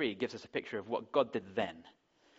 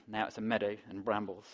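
ordinary talk

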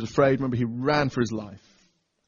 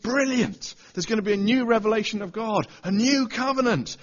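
excited listener; 6 semitones higher, 4 dB louder than usual. Retold or imitated. Preacher voices the listener's hopes.

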